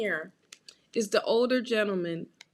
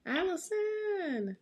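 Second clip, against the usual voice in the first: drawn-out name; 7 semitones higher, 6 dB quieter, much slower than usual. Acted. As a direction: stretch the name slowly, higher.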